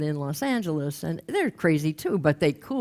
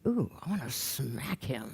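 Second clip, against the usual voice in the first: slow, low, soft mock menace; simmering irritation; 4 semitones lower, 9 dB quieter, slower than usual. Woman looking at Ronnie in group, simmering irritation. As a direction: seethe quietly and draw it out.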